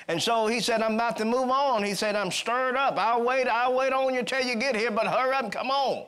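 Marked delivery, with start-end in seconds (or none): 0.8-1.8 high, loud eager report of a revival; excited phone call. Acted. Philip phoning Jerusalem. 2.1-6.0 high, loud eager report of a revival; excited phone call. Acted. Philip phoning Jerusalem.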